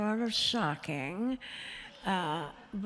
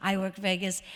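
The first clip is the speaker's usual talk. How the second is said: ordinary delivery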